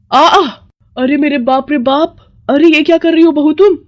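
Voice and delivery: loud high shriek of horror. Acted. Mother-in-law sees her daughter-in-law catching lizards, loud shriek. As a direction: scream at the sight of the lizard. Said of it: "वो जोर से चिल्लाती है".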